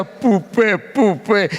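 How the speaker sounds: a father mimicking his tearful daughter; imitating crying toddler. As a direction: lightly mimic a small child crying out. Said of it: "mi hija lloraba, me decía".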